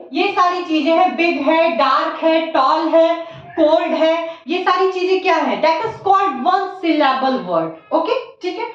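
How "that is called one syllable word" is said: slow statement. Shown as a demonstration. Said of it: "teacher states rule slowly".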